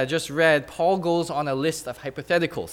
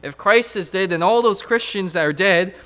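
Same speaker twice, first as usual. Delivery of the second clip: rising, louder preacher cadence, hammering each if; building preaching; 3 semitones higher, 5 dB louder than usual. Acted. Preacher to his church, building to a loud climax. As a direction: drive each hypothetical home to the congregation.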